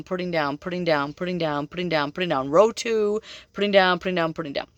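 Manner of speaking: normal talking voice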